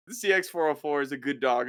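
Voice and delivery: ordinary talk, nothing marked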